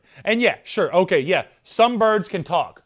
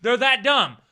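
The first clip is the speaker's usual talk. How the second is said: ordinary talk, nothing marked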